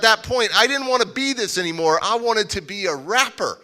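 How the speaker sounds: usual speaking voice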